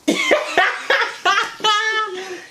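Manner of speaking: high, long cackle of laughter; real laugh. Real, not acted. Big brother teasing his scared little brother, high laughing.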